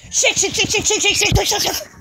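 rapid high panicked swearing; panicked cursing. Real, not acted. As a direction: blurt it out in a panic.

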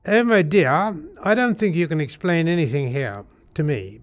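loud, high British officer voice; hammed-up British condescension; very British accent. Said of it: "And in a very British accent".

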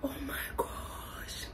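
sharp gasp into a breathy oh my gosh; awed gasp. Real, not acted. Opening sneaker gift, stunned gasp. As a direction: gasp as if struck by the sight.

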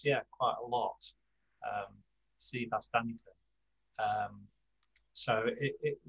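ordinary talk, nothing marked

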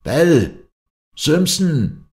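high, ringing shouts of names; calling out. A narrator's reading. Travellers hail each other above the fog, calling out. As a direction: hail companions far off, pitched high. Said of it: "erschollen die vier Zurufe".

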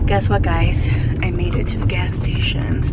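hushed, breathy whisper of relief; whispered news. Real, not acted. Vlogger whispering to viewers that she reached the gas station, hushed. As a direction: whisper it like a secret win.

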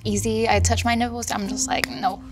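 ordinary talk

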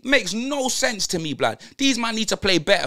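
ordinary talk, nothing marked

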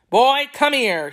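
put-on a boss yelling for the boy. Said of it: "he yelled".

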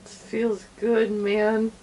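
tipsy and slow. Real, not acted. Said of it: "so wasted".

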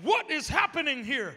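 bewildered demand. Retold or imitated. Police officer questioning pastor about the man, bewildered.

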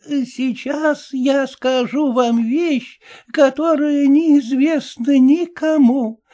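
put-on high, slow confiding old dame; old woman solemnly unveiling a secret; secretive old lady. A narrator's reading. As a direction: lean in and reveal a deathbed secret.

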